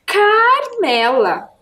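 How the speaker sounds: CARMELA stretched out, high on the first syllable; drawn-out syllables. Shown as a demonstration. Teacher revealing the character's name to pupils, "Carmela" drawn out. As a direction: say "Carmela" slowly with a long, high "Car".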